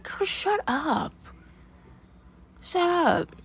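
high, very slow eye-roll dismissal; sassy fed-up hush; exasperated sass. Retold or imitated. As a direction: drawl it out, done with her. Reacting to crying Cassie.

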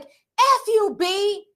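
put-on boy firing back at teacher; kid cussing back. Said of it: "He's like".

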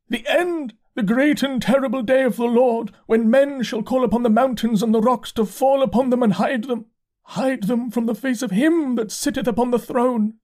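high, loud prophetic preaching; doom-preaching curate. A narrator's reading. Demented curate preaching doom at the narrator, high and fervent.

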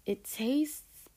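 slow, high, puzzled and feeble; feeble frown. Acted. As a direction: taste it and wince weakly. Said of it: "I said with a feeble frown".

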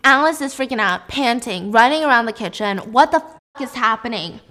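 loud, breathless rush of panic; frantic retelling. Acted. Narrating Alice's meltdown to a friend, loud and frantic. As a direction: tell it like you're panicking with her.

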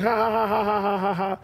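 high, spelt-out teasing laugh; mock laughter. Son laughing off his joke to mom, mock laughter. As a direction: imitate your own joking ha-ha-ha. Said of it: "I'm like".

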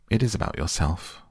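ordinary delivery

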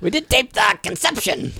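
high, oddly punched comedian delivery; Dennis Wolfberg. Retold or imitated. Fan quoting a late comic's bit, imitating his delivery. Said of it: "specific delivery".

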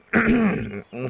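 small, hesitant throat clear; timid ahem before speaking up; nervous throat clearing. A narrator's reading. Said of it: "cleared his throat nervously".

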